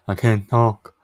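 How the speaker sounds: talking with a numb mouth after the dentist; words slurred through a numb cheek. Real, not acted. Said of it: "this side of my face is numb".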